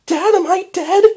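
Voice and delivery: very high, loud frightened cry; frightened young mare voice; panicked. Acted. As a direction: scream the question in panic. Panicked daughter.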